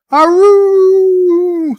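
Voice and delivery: long high wolf howl. Pretend. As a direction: throw your head back and howl. Hailing a donor, howl.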